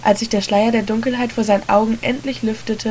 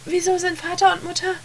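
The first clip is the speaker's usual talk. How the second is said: put-on terrified child; 10 semitones higher, 3 dB quieter than usual. A narrator's reading. Terrified child questions brother.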